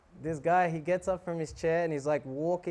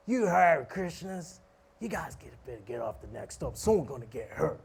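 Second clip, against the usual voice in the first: put-on slurred threat from a drunk man; angry drunk. Drunk passenger threatens the chanting monks, angry drunk. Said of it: "he starts getting angry at us".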